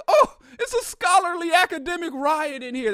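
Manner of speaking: high, loud mock astonishment; mock amazement. Teller marvels at the academic riot, high mock amazement. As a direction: act amazed at the polite chaos.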